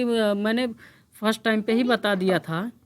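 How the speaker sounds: ordinary talk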